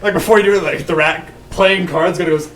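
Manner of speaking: ordinary talk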